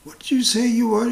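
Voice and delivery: hammed-up shocked disbelief; alarmed wife. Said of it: "She said".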